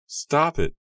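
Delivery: high frightened shriek. A narrator's reading. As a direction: cry out for it to stop, up high. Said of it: "Nora shrieked".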